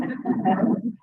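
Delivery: a quick bright laugh; short laugh. Real, not acted. Speaker laughing at his own joke, a quick laugh. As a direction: laugh it off lightly.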